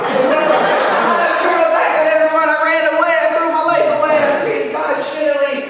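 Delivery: high, loud, rushed outpouring; frantic high-pitched wail; distraught confession. Acted. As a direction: cry it out as a distraught kid confessing. Boy's desperate confession to old man.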